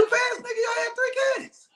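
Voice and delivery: very high-pitched exclamation; squawked disbelief, voice shooting way up; incredulous outburst. Real, not acted. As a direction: cut in with a high, disbelieving shout. Co-host cuts in, mock disbelief.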